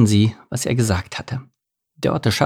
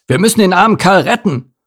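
raised, forceful rescue commands; bossy bear rallying the helpers; urgent orders; 5 semitones higher, 5 dB louder than usual. A narrator's reading. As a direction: take charge, loud and insistent. Bear takes charge of the rescue.

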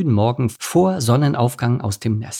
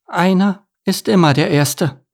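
mildly gloomy, higher-voiced reply; gloomy duckling; 8 semitones higher than usual. A narrator's reading. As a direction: answer with a touch of doom as the duckling. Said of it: "erwiderte Enno mit düsterer Stimme".

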